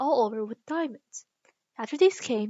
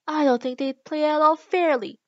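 higher, louder voice of complaint; complaining; 4 semitones higher, 7 dB louder than usual. A narrator's reading. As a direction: grumble indignantly about unfairness. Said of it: "in a rather complaining tone".

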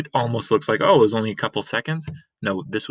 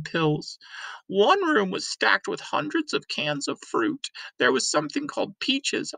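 put-on much higher, dreamy old woman; reminiscing Granny. Granny telling Lena about her girlhood, higher and dreamy. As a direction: remember fondly, drifting.

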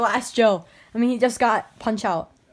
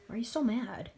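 softer, whispered question; 10 dB quieter than usual. Real, not acted. Asking a friend quietly why he is mad, whispered.

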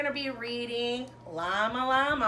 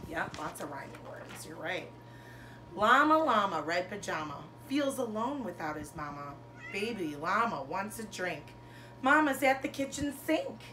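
lilting picture-book read-aloud, bigger on each Llama Llama; reading to children; 2 semitones higher than usual. Acted. Teacher reading a rhyming bedtime book to her class. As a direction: read it like a bedtime story for little kids.

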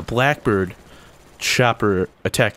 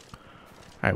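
whisper; 11 dB quieter than usual. Real, not acted. Hiding, waiting for prey.

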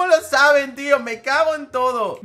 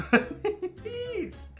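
startled; 12 semitones higher, 4 dB quieter, much slower than usual. Real, not acted. Startled at odd character faces.

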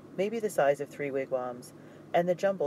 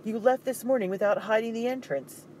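loud, higher scolding blame; harsh scolding; 8 semitones higher, 4 dB louder than usual. Acted. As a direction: berate him for the careless mistake. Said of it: "his voice rang loud and harsh".

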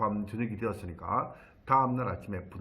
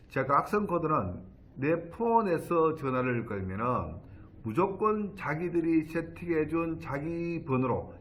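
normal talking voice

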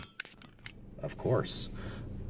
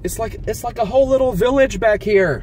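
high and louder with wonder; amazed; 11 semitones higher, 5 dB louder than usual. Real, not acted. Driver discovering a hidden village, high amazed wonder. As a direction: marvel at the whole village.

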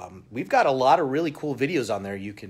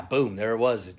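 normal talking voice

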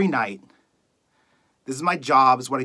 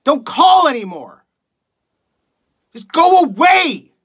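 high-pitched, shouted theatrical fury; furious outburst; 6 semitones higher than usual. Acted. Radio host begs callers to stop calling, furious shouting. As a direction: explode at them, voice pushed up high. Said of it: "reading a monologue for you guys".

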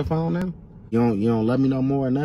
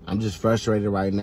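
sheepish apology; 8 semitones lower, 4 dB quieter than usual. Acted. Apologizing after insult.

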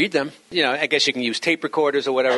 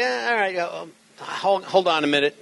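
hesitant stalling; 4 semitones higher than usual. Acted. Acting an operator with nothing to say.

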